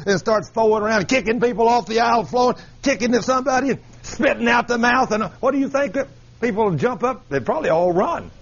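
ordinary talk, nothing marked

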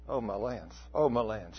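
low, soft murmur of realization; hushed dismay. Retold or imitated. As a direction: imitate a stricken listener muttering to herself. Retells girl's dawning remorse.

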